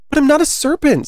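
put-on high-pitched storybook child; high, affronted character denial; protesting girl. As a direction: insist in a high, emphatic girl voice. Alice protests the accusation.

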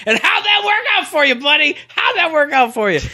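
high, loud taunting call; mocking yell. Co-host taunts the host about his failed promise, loud and high. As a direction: yell a gleeful taunt at your buddy. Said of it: "I was gonna yell out".

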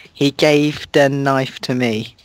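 ordinary talk

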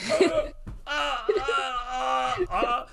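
high, stunned, halting uhs; lost for words, groping for a reply; speechless stammer. Retold or imitated. Retelling being stunned by a coach's question, high halting stammer.

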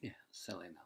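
hushed, quick whispered tease; playful whisper. Acted. Grandchild whispering back to tease Nana. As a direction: whisper back like sharing a joke.

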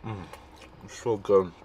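chewing while talking; covering mouth while chewing; mouth full of burrito. Real, not acted. Seen 0: Bites burrito at -4, pursed full cheeks, hand covers mouth while talking.

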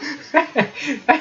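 real amused laughter; helpless chuckling; hearty laugh. Real, not acted. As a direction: laugh heartily at your own joke. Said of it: "Je ris de bon cœur".

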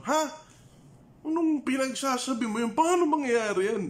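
high-pitched mocking disbelief in Tagalog; incredulous Tagalog scoff, high and sarcastic. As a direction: scoff at the angel as if it's absurd. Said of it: "maybe he was sarcastic".

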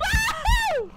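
wild cackle with a loud whoop; crazy laugh. Shown as a demonstration. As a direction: shriek a manic laugh on request. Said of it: "Meinst du so?".

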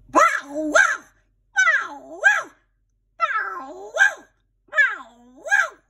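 high squawky bow wows; parrot barking. A narrator's reading. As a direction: bark it like a parrot faking a dog. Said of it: "Tilly barked so long and so loud".

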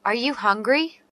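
ordinary talk

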